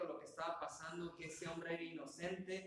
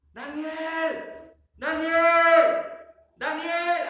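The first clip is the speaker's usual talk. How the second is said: high, very loud shouted name; full-voiced, high-pitched holler; calling out loudly; 11 semitones higher, 19 dB louder, much slower than usual. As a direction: cry it out as if into a pit. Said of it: "llamó a voces a Daniel".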